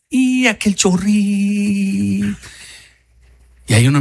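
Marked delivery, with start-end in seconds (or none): 0.1-2.4 one long held, stretched final vowel; drawn-out trickle. Acted. Preacher mocking a feeble hose filling a pool, drawn-out mimicry. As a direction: stretch "chorrín" out thin and very slow.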